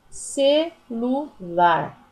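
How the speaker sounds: "Celular" very slowly as CE, LU, LAR; teacher sounding out "Celular", a pause after each syllable. Shown as a demonstration. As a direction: break "Celular" into three separate beats. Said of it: "Quando eu separo a palavrinha".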